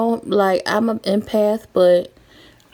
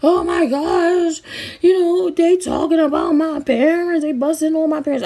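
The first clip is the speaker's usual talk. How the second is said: much higher, put-on whiny voice, rushing at the end; mock upset kid; 10 semitones higher than usual. Mocking classmates who got upset over roasts, high whiny voice. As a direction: play a fussy kid hurt by a roast. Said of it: "I wasn't on no".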